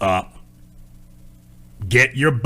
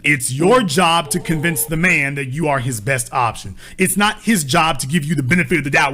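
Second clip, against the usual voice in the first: usual speaking voice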